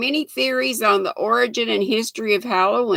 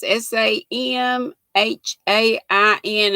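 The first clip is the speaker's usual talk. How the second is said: ordinary talk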